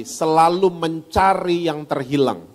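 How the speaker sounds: normal talking voice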